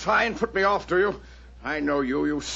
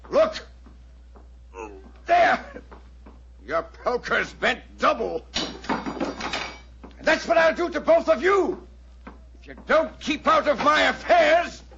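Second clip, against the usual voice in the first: raised, menacing bark; shouted threat; 8 semitones higher than usual. Acted. Roylott threatening Holmes and Watson, shouting in fury. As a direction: threaten them with brute force.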